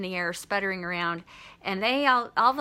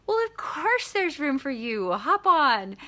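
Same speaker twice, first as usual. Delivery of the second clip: higher, lightly voiced obliging witch; welcoming witch; 6 semitones higher, 3 dB louder than usual. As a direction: invite them aboard with a high, mildly acted lilt. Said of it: "the witch says".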